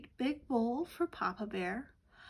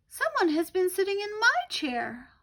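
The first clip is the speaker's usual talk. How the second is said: high, much louder little bear cry; the smallest bear, wailing; Baby Bear upset his porridge is all gone, high and loud; tiny little Baby Bear voice; 9 semitones higher, 7 dB louder than usual. Acted. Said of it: "Baby Bear cried in his tiny little voice".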